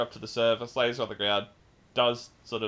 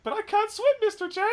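much higher, put-on squeaky Harley voice; Harley's plea; 5 dB louder than usual. Harley to the Joker sinking in the car, high squeaky plea. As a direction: squeak it like a panicked cartoon girl. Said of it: "And he's like".

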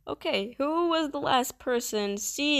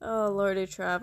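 ordinary talk, nothing marked

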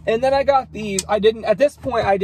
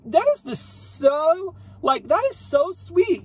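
high, slow gushing delight; 10 semitones higher, slower than usual. Retold or imitated. Telling viewers how she reacted to the cashier, high gushing delight. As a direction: gush over the sweet cashier, pitched way up. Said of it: "And I was just like".

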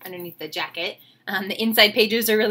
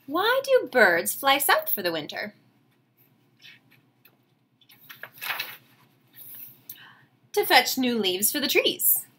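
storybook reading; 4 dB quieter than usual. A narrator's reading. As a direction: savor each line like a bedtime story. Teacher reads picture book aloud.

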